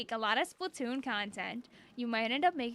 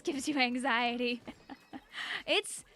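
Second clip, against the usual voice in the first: usual speaking voice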